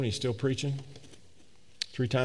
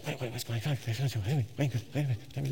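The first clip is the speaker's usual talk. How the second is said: hushed urgent whisper; 6 dB quieter than usual. Retold or imitated. Wife whispering in church pew.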